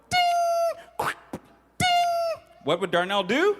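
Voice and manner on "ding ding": duck's ding. Acted. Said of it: "Darnell Duck would go".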